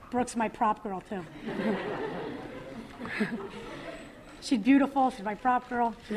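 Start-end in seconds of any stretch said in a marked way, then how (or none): 1.3-4.4 quiet laugh; gentle laugh; soft breathy laugh. Real, not acted. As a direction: laugh softly. Laughs with audience.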